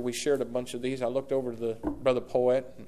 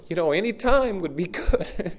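laughing plea; 5 semitones higher, 6 dB louder than usual. Retold or imitated. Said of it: "He's saying".

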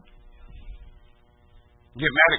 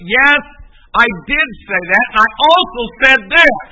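loud, raised insistence; emphatic affirmation; 6 semitones higher, 9 dB louder than usual. Real, not acted. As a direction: own up to it forcefully. Preacher answers an imagined objection.

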